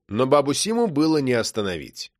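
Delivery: ordinary delivery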